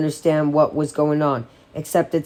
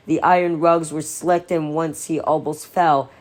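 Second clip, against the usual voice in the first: usual speaking voice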